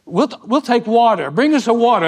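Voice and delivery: hearty loud call for water; loud retold order. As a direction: announce it loud across the table. Man on the cruise switching to water.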